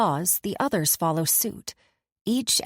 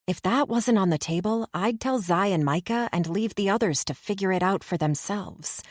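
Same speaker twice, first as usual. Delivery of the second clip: normal talking voice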